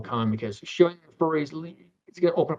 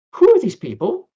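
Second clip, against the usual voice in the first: loud, high, incredulous question; mock bewildered; 8 semitones higher, 10 dB louder than usual. James asks him about the furries, bewildered. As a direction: imitate a baffled newcomer, raised and louder. James retold asking in disbelief.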